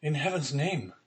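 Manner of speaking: high, pleading cry; desperate cry. A narrator's reading. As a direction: beg him in anguish. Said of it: "cried the unhappy young man".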